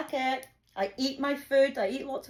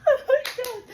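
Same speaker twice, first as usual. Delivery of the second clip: high, loud laughing exclamation; real laughter; 13 semitones higher, 7 dB louder than usual. Real, not acted. Cracking up at her own boot story, high laughing squeal.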